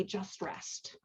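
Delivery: whispered soft "Just rest"; calming whisper. Shown as a demonstration. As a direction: whisper it to soothe someone waking up. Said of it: "whatever people say when they're calming you".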